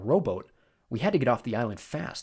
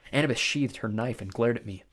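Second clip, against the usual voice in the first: usual speaking voice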